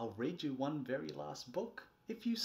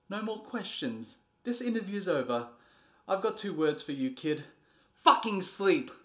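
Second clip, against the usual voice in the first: louder, higher, cutting it off; fed-up command; 6 semitones higher, 8 dB louder than usual. Acted. Parent shutting down the child's questions, fed up and loud. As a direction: end the discussion firmly.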